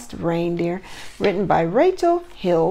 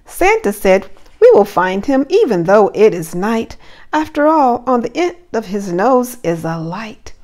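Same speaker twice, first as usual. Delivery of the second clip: lively rhyming storybook read; reading to children; 2 semitones higher, 8 dB louder than usual. Acted. Teacher reading a Christmas story to her class, lively storybook voice. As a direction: read the verse aloud with bounce for little ones.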